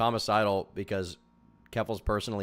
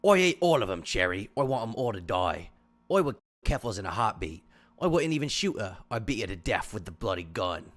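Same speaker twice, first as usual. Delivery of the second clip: imitating William Butcher, a British tough guy, pitched up at the start. Friend mocking leaked DMs by reading them as Butcher, British accent. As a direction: growl the threats in a mock British accent. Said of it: "Who's the British guy in The Boys?".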